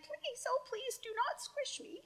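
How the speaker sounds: high, pleading little ant; tiny ant voice. Acted. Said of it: "says the ant".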